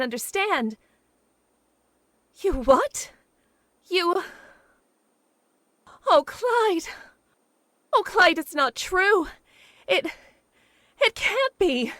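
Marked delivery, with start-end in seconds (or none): 2.4-11.9 higher and louder, rising panic; shocked pleading. Acted. Woman jilted on the phone, pleading in shock. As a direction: cry out in disbelief as he breaks it off.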